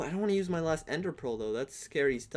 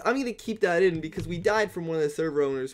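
normal talking voice

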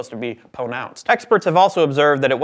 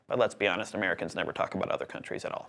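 fast conspiratorial whisper, much softer; whispered aside; 7 semitones lower, 12 dB quieter, faster than usual. Acted. Host confides a joke about Americans to viewers, whispering. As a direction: let it slip under your breath, quickly.

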